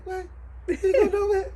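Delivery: squeaky high mock outrage; mock shocked. Teasing the friend who felt cheated, high mock outrage. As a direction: play the cheated passenger, overdone.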